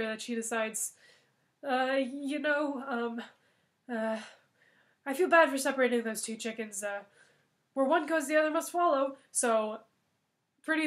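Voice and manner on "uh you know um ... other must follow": a touch of hammed-up guilt; Gretel excusing herself. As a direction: talk yourself into it. Gretel justifies eating second chicken.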